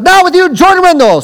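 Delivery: high, loud hype announcer call; hammed-up emcee introduction; announcer intro. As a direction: introduce the speaker like an MC. Mock emcee announcing the speaker.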